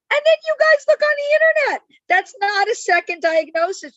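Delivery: loud, high and rushed outburst; high-pitched, fast, exasperated telling-off; exasperated scolding. Real, not acted. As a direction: raise your voice sharply to chide the audience. Scolding audience for internet diagnoses.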